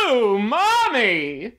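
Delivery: slow drawn-out surprised ooh; surprised exclamation. Retold or imitated. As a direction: gasp it out slowly in wonder. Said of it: "That's what the scientists will say".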